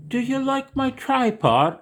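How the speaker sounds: very high, loud sing-song pet talk; talking to the dog. Acted. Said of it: "He hears a version of Daddy".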